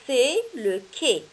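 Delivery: slow, clear "c'est le quai"; slow model word. Shown as a demonstration. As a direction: say "le quai" slowly for children. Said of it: "models word slowly".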